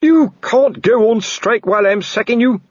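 spluttering at the strike; furious shout. Acted. Colon furious in quarrel.

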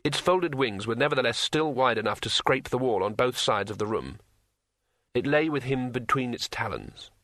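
plain reading voice

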